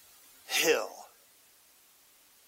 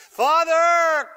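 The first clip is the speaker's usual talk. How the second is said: anguished cry; 9 semitones higher, 7 dB louder than usual. Acted. Jesus cries out during nailing.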